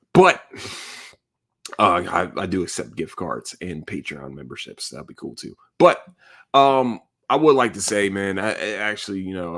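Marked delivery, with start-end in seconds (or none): none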